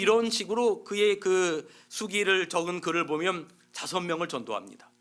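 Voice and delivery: normal talking voice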